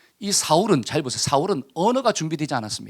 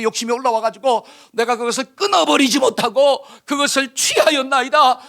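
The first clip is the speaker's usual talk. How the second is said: grovel before the prophet, crying out your sorry; begging forgiveness; 5 semitones higher than usual. Acted. Saul begging Samuel's pardon for his sin, high pleading cry. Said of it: "싹싹 빌어야 될 거 아니에요".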